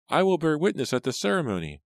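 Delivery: put-on very high child's voice, dropping at the end; excited little girl. A narrator's reading. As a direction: announce it eagerly as a small girl. Excited Rachel continues her exclamation.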